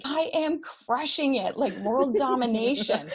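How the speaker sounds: hammed-up victory; mock triumphant. Calling her husband to boast of success, mock triumphant. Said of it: "I call my husband and I'm like".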